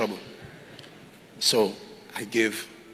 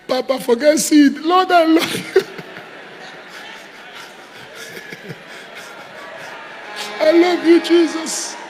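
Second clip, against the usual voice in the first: ecstatic worship; 9 semitones higher, slower than usual. Real, not acted. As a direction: shout your love to God, overcome.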